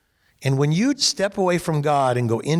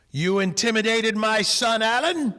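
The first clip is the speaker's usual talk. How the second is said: a higher, accusing challenge; confronting father; 7 semitones higher than usual. Retold or imitated. Father confronting the coach, higher and accusing. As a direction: call him out on it.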